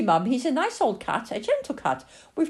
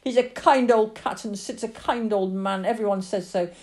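put-on raised, loud child's outburst, building in force; screaming tirade; 7 semitones higher, 8 dB louder than usual. Lily screams at her father about the cat, impassioned fury. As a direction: hurl accusations, building to sustained fury. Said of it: "screamed at her father, tears pouring down her face".

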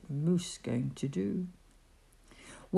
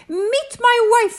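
much higher, louder, slow; proud bird; 9 dB louder than usual. Acted. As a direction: show off the new wife. Bird introduces his wife.

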